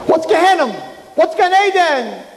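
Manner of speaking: high and lost, asking the angels; bewildered soul. Acted. Chaim asks the heavenly court, high and bewildered.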